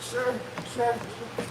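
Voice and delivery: soft, hesitant call for attention; gentle nurse. Retold or imitated. Nurse to a groaning patient who she feels bad for, soft coaxing. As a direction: quietly try to get his attention.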